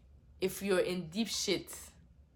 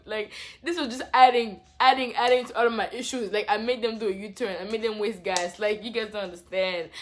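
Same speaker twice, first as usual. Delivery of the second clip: frustrated; 3 semitones higher, 9 dB louder than usual. Real, not acted. Frustrated self-blame.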